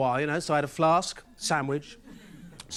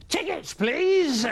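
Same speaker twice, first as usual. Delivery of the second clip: put-on drunk ticket man; 8 semitones higher, much slower than usual. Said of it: "he goes".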